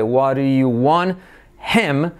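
"What do you" as "waru-yu", then "want" without its t; slow "wa-r-you wan... him"; soft R linking. Shown as a demonstration. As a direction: tap an r in "What do". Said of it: "se convierte en R suave".

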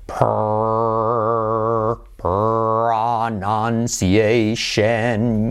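"pronunciation" stretched out with a long English R; low, very drawn-out "pronunciation"; extreme slow model. Shown as a demonstration. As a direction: exaggerate each sound of "pronunciation", very slowly. Teacher's exaggerated slow model.

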